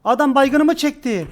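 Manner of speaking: excited outburst. Real, not acted. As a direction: blurt it out in disbelief. Teammate's downed player taken.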